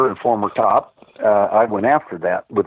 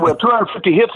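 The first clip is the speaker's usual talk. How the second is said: retold furious rant, much higher pitch; 9 semitones higher, faster than usual. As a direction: imitate the angry webmaster yelling. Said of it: "He was furious".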